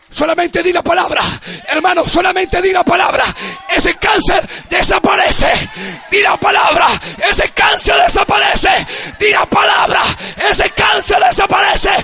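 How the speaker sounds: loud rising exhortation; fervent preacher building the declaration; preaching at full force. Acted. Pastor urging church to declare.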